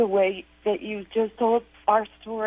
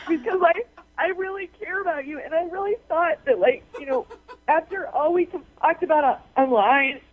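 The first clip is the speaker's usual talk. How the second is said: real tearful, higher voice; tearful and halting, pitched up; crying; 3 semitones higher than usual. Real, not acted. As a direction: plead through tears. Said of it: "Why are you crying?".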